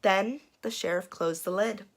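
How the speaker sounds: usual speaking voice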